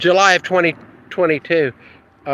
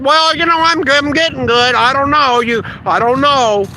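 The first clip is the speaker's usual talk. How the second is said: high, loud, dithering surgeon voice; a squeaky, flustered doctor stammering out excuses; mocking unsure surgeon; 10 semitones higher, 4 dB louder than usual. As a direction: ham up a surgeon who can't admit he's any good. Hesitant surgeon answering, mocked.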